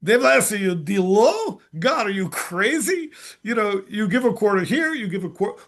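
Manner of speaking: high, loud indignant outburst at God; a young boy's high, loud mock outrage; outraged kid. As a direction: protest like a scandalized boy, voice up high.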